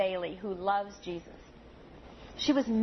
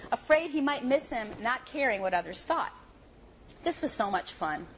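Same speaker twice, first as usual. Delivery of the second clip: ordinary talk, nothing marked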